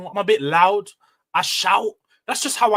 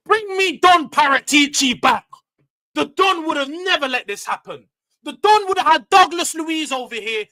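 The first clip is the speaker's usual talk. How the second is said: loud demand; 6 semitones higher, 6 dB louder than usual. Real, not acted. Fan on stream demanding the old director back, loud and high. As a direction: shout it up high like a desperate fan.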